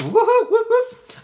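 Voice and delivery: loud, high mock whoops; playful string of loud whoops; high-pitched whooping. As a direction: whoop away in a silly high voice. Spelt noise breaking into own sentence.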